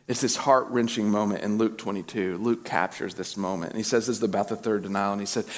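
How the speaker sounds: ordinary delivery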